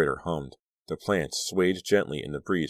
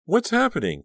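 sleepy yawning question; 5 dB louder than usual. A narrator's reading. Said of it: "asked with a huge yawn".

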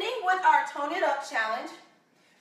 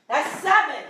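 slow, louder, effortful counting between burpees; breathless coach counting mid-burpee, louder; out of breath; 3 semitones higher, 3 dB louder, slower than usual. Real, not acted. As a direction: push the count out through heavy breathing. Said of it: "Now I am out of breath".

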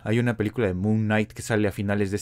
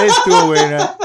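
laughing; 10 dB louder than usual. Real, not acted. Bursts out laughing at comment.

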